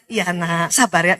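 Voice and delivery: put-on calm the baby down tenderly; gentle mother. Mother hushing her crying baby, softly reassuring.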